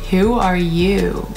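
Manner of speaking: usual speaking voice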